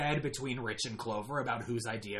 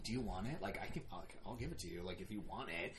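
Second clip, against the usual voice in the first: hushed, much softer and lower; retold whisper; 8 semitones lower, 14 dB quieter, faster than usual. Player quietly offering Rich the win, retold whisper. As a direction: drop right down to a confidential murmur. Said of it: "I whisper him".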